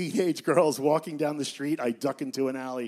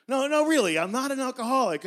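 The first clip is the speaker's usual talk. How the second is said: higher, louder insistence; eager protest; 10 semitones higher, 5 dB louder, faster than usual. Acted. Ed insists to the silent room he's not alcoholic, higher and pushy. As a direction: protest hastily.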